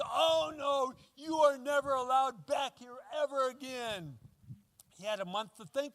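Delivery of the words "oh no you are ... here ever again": angry shout. Retold or imitated. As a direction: shout him away from the door. Fred angrily refusing pastor.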